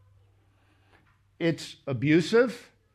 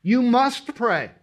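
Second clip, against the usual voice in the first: You must pray, with must hit loud and slow; stress on must; 6 semitones higher, 5 dB louder, slower than usual. Shown as a demonstration. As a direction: lean hard on the word must. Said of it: "Do you see the word must?".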